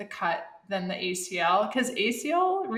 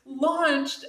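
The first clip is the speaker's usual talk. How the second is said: ordinary talk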